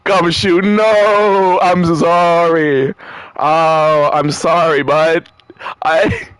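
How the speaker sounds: drawn-out, pleading wail; whiny mock crying. Stressed caller begging a friend's pardon, whiny mock crying. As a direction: whine the apology, stretching the words tearfully. Continued wailed apology to Gumshoe.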